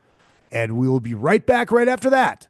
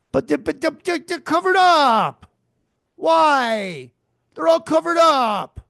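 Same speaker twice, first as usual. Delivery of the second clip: rising exasperated complaint, higher and higher; frustrated escalating rant; indignant outburst; 7 semitones higher than usual. Real, not acted. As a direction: blow up at the screen in disbelief. Ranting about covered breasts.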